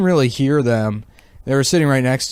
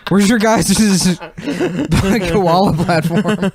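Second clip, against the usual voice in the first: laughing through quote; 6 semitones higher than usual. Retold or imitated. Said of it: "he's like".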